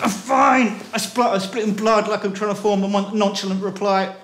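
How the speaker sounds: high, loud, forced "I'm fine"; strained nonchalance. Acted. Splattered on the pavement, telling Lucy he's fine, high and strained. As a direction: force out a casual reply while hurting.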